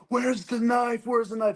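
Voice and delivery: MP's shout. Retold or imitated. Military police officer yelling at a wounded soldier. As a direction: bark the question at him like a cop. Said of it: "she goes".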